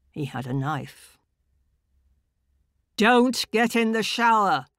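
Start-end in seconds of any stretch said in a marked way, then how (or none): 2.9-4.7 loud, high barked warning; barked bullying order. Acted. Inner voice forbids the woman to shower, loud bark. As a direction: bark it as a command. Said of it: "this awful bullying voice".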